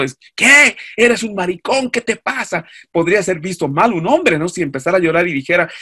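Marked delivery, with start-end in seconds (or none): 0.3-2.7 high, incredulous scolding; mock outrage. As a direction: burst out high and loud, then ease off. Acted macho reaction to crying man.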